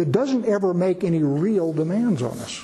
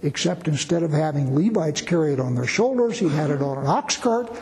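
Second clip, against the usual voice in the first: ordinary talk, nothing marked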